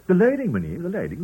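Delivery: high, loud, flustered reply; hasty cowed answer. Acted. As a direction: blurt the right answer fast. Manager answers the shout hastily.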